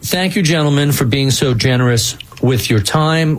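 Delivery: usual speaking voice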